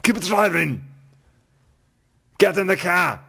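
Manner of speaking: action hero yell. Retold or imitated. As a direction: bark the orders like a hammy action hero. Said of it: "doing all these terrible one-liners".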